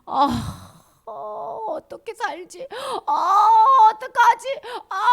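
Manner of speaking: high, loud, hammed-up wailing; mock anguish. Child tormented by Satan's words, mock anguished wail. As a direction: act out a panicked, tearful outcry. Acting a head overwhelmed by temptation.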